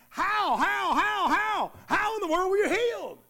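stunned disbelief, almost shouting; amazed neighbor's outcry. Retold or imitated. Neighbors amazed at the healed blind man, shouting in disbelief. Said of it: "the original language re-, reads".

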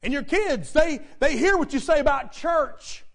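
forceful preacher hammering the key words; emphatic preaching. Real, not acted. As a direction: press the point on the congregation. Preacher presses the point.

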